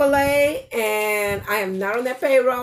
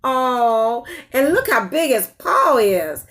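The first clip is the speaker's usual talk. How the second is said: ordinary delivery, nothing marked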